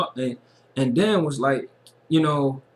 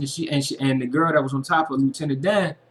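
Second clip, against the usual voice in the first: ordinary talk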